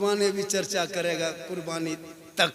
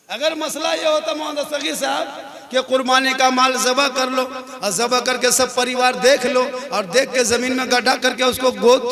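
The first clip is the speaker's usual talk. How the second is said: loud, driving sermon rebuke; fiery preaching; 5 semitones higher, 7 dB louder than usual. Acted. Preacher scolding the faithful over sacrifice meat, loud and driving. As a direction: hammer the point home to the congregation.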